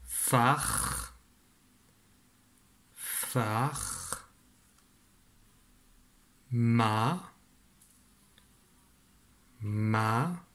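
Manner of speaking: long drawn-out syllables with wide pauses. Shown as a demonstration. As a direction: dictate each syllable slowly, leaving time to write. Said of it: "on commence par écrire". (dictée très lente, syllabes étirées)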